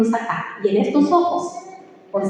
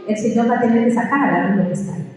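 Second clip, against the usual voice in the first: ordinary talk, nothing marked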